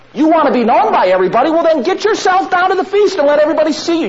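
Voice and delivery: high, loud, fast taunting; a brother jeering, go on down there; scoffing brother. Retold or imitated. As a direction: needle him like a mocking sibling. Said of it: "James says".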